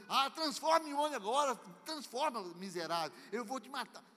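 loud, high furious yelling; berserk rage. Retold or imitated. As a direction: threaten the stump in a blind rage. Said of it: "querendo matar o toco".

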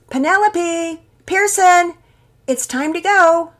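high, loud motherly call; yelling upstairs. A narrator's reading. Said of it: "Mama Peters yelled upstairs".

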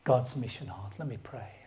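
ordinary talk, nothing marked

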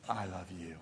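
soft, low, hushed I love you; tender I love you. Retold or imitated. Pastor recalling his wife's first I love you, soft and tender.

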